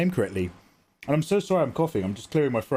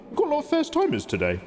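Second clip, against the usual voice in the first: high excited; 14 semitones higher than usual. Real, not acted. Excited about new viewers.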